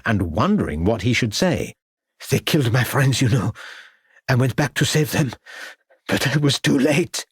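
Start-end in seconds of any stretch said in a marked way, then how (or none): 2.3-7.3 tearful. Acted. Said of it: "tears began to form in his eyes".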